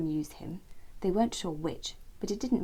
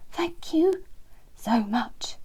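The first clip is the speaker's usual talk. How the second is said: thankful friends voice; 3 semitones higher, 4 dB louder, much slower than usual. A narrator's reading. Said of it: "they both said together".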